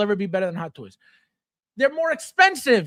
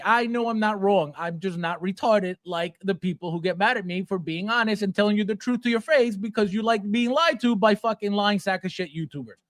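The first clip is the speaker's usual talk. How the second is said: usual speaking voice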